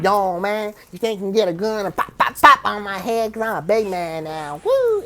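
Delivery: hammed-up big-man teasing; joking taunt. Joking at an old bully, mock tough talk. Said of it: "started doing a little joking to this".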